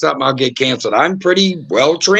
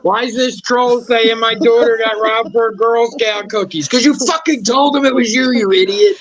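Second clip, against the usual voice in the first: high, incredulous mock scolding; mock exasperation; 7 semitones higher than usual. Podcaster ridiculing people who overshare about family, mock exasperated. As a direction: climb higher as you berate them, half in jest. Mocks complainer, then scolds them.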